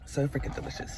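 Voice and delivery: unvoiced, savouring whisper. Real, not acted. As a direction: whisper it to yourself in delight. Repeats praise of the latte.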